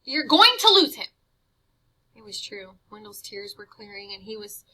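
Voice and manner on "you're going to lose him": hissed urging. Acted. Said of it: "Jive hissed".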